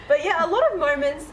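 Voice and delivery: ordinary delivery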